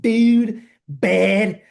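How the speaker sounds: two loud words in Philly vowels; exaggerated Philly pronunciation; Philly accent. Shown as a demonstration. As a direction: stretch "dude" and "bad" the Philadelphia way. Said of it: "pronouncing their A's".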